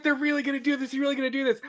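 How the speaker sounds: high, stunned disbelief. Retold or imitated. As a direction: say it like you can't believe it's happening. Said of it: "I was like".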